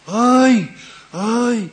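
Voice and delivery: loud, high wail of "ay, ay"; acted pain cry. Community leader faking illness wails to his household, loud pained cry. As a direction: cry out as if seized by sudden pain. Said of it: "מתחיל לצעוק".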